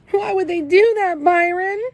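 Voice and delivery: high, loud voice breaking with sobs; crying. A narrator's reading. Kenny asks his brother Byron why, crying high and loud. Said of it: "I was sounding real bad".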